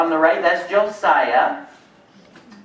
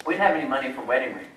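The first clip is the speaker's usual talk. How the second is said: usual speaking voice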